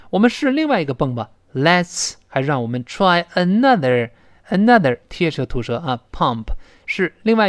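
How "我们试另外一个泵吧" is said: usual speaking voice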